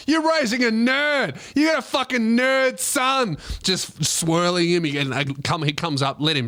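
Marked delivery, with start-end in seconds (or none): 0.1-3.4 bully sneering at a nerd, overblown; mock bully yell. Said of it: "in front of his dad".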